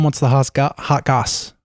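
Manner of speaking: ordinary delivery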